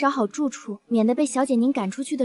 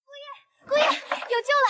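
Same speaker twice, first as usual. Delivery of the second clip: excited calling out; 12 semitones higher, slower than usual. Acted. Maid bursts in calling out.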